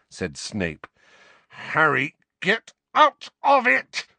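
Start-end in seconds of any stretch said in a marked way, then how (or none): 1.5-4.1 high, loud, slow snarl; furious, forceful command; angry snarl. A narrator's reading. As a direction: snarl the order, stretching each word. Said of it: "snarled Sirius".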